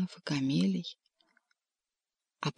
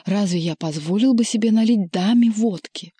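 louder, higher croak of indignation; hoarse, offended cat; 3 semitones higher, 5 dB louder than usual. A narrator's reading. Offended cat defending his drink to Margarita, hoarse and indignant. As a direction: protest hoarsely, stretching out the last words. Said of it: "прохрипел он".

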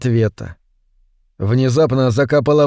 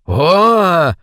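higher, drawn-out monster howl; creature's cry; 5 semitones higher, 4 dB louder than usual. A narrator's reading. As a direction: answer with a long eerie cry. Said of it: "ответила чёрная тварь".

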